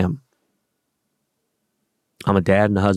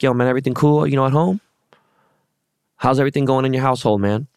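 mock-friendly rude probe; 5 semitones higher than usual. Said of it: "it was a very rude, bold statement".

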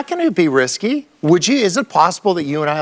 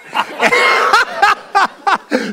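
real helpless laugh, pitched way up; high burst of laughter. Real, not acted.

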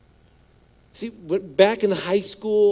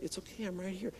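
soothing parent; 3 semitones higher, 16 dB quieter than usual. Retold or imitated. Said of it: "You grab them, like".

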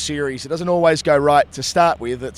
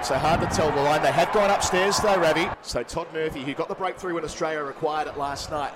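live play-by-play, rising at wickets; cricket commentator; 7 semitones higher than usual. Acted. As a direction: call the ball-by-ball action live.

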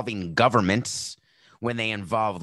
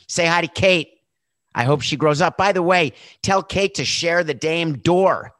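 loud, higher-pitched man going down with the ship; Florentino Pérez; 4 semitones higher, 6 dB louder than usual. Acted. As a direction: call out cheerily insistent all's fine, hammed-up. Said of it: "picture him standing there playing his violin, saying".

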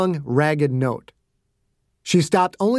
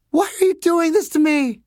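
high, pleading question; anguished patient, pitched way up; distraught girl; 13 semitones higher than usual. Acted. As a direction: plead with your captors for a reason. Captive girl questions her captors.

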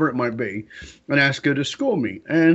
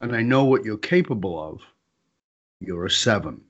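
very calm; 4 semitones lower than usual. Retold or imitated. Wife telling husband he plays small, very calm. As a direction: deliver the truth evenly, pausing between phrases. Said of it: "Wife very calmly".

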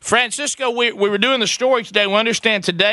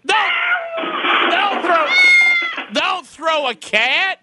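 slow pleading cry; 13 semitones higher, slower than usual. Real, not acted. Host pleads, slow and loud.

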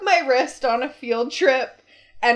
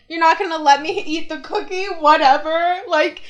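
imitating a whiny kid's protest, building louder; little kid tantrum; 5 semitones higher, 6 dB louder than usual. Daughter retelling her sister's fight with mom, mock childish outburst. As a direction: act out a child fighting with mom, climbing in pitch. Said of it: "little kid fights where it's like".

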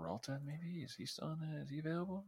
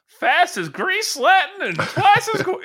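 put-on very high, loud old-timey patter voice; hammed-up slang from the twenties, slowly drawn out; twenties talk; 7 dB louder, slower than usual. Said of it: "talked about like that".

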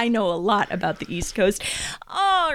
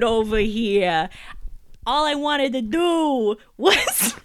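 mock Boston accent, slow and a bit higher; bad Boston Jason Bourne impression; 4 semitones higher, slower than usual. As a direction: ham up a Boston tough guy, badly. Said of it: "do a bad impression for thirty seconds of Jason Bourne".

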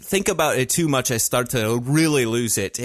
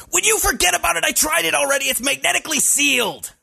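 yelly whiny Luke Skywalker; faster than usual. Retold or imitated. Doing Luke in the garbage compactor, yelly and whiny. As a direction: whine it like a teenager stuck in a trash compactor. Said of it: "monotone, Luke Skywalker ... yelly and whiny".

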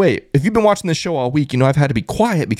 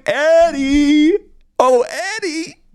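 high, drawn-out teasing call; taunting high-pitched holler; mock calling out; 8 semitones higher, much slower than usual. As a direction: call the name like searching for someone missing. Calls out mockingly for absent rival.

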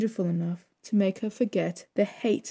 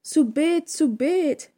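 put-on high loud toddler shout; 8 semitones higher, 5 dB louder, much slower than usual. A narrator's reading. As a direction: yell like a demanding toddler. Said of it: "shouted the toddler".

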